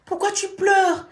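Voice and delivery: put-on anxious boyfriend asking why she cries; worried boyfriend. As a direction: voice him pressing her anxiously. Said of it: "Il me dit".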